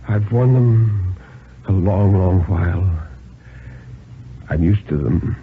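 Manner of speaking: put-on slow, humble old man's plea; feeble, humble plea. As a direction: beg meekly, slowly. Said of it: "very feebly, very slowly, very humbly".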